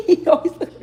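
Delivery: laughing through the words; giggling at the dog's look. Real, not acted. Laughing at how the dog always looks.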